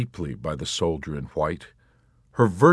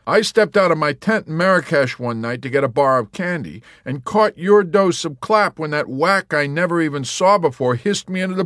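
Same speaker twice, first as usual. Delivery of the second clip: high, loud aggrieved tale; Yossarian; 10 semitones higher, 7 dB louder than usual. A narrator's reading. Yossarian griping to the warrant officer, loud and high. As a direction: complain with wry outrage.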